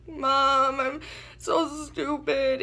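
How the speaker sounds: high, slow, teary wail; retold crying. Crying to her mom after the scam, high and slow. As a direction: break down to mom, drawn out. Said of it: "started crying".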